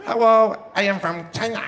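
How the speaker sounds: exaggerated mock Chinese accent. Shown as a demonstration. Comic proving his point to the crowd, mock Chinese accent. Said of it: "that was the accent I did the best".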